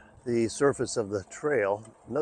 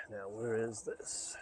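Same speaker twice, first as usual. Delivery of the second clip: whispering; 10 dB quieter, slower than usual. Real, not acted. Searching alone for the trail.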